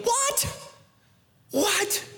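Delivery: high, stunned disbelief; can't believe what they're hearing; mock astonished squeak; incredulous. Incredulous reaction.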